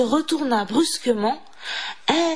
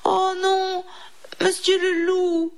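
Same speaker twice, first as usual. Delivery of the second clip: high-pitched pleading little mouse; 8 semitones higher than usual. A narrator's reading. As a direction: beg the wolf for mercy in a high voice. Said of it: "supplia Didi".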